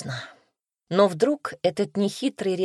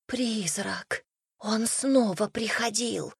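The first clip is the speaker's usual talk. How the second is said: trembling whisper; 4 semitones higher, 5 dB quieter, slower than usual. A narrator's reading. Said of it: "дрожащим шёпотом сообщила Бет".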